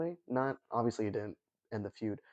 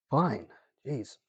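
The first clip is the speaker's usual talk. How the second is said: annoyed sigh; 4 semitones higher, 5 dB louder than usual. Retold or imitated. Juan Diego annoyed, gives in.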